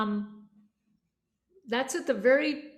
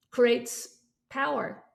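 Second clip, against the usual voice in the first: ordinary talk, nothing marked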